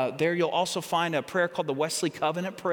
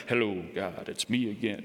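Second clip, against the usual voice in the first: hammed-up deep, soft praying voice; solemn prayer voice; 12 semitones lower, 5 dB quieter than usual. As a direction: drop very low like a grand movie-star orator. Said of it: "gotta sound like James Earl Jones or Charlton Heston".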